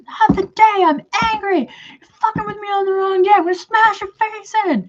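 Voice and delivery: high-pitched furious rant; screamed imitation. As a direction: screech it like the angry stranger. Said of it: "she screamed at me".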